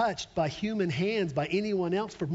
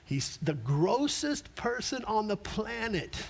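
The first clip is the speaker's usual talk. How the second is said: usual speaking voice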